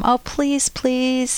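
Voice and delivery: hammed-up begging to twist his arm; imitating her old pleading. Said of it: "I would have tried to twist his arm".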